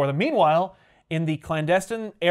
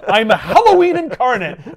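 higher and louder, playful grandiose claim; mock villain boast; 9 semitones higher, 9 dB louder than usual. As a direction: puff it up like a cartoon goblin. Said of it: "what are you selling".

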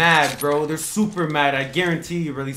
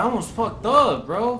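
excited relief; 8 semitones higher, 5 dB louder, slower than usual. Real, not acted. Near miss from lag.

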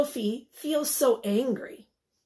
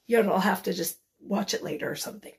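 usual speaking voice

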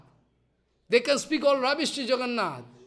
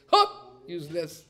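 sudden shout; 4 semitones lower, slower than usual. Retold or imitated. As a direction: bark it out suddenly. Krishna shooing someone off.

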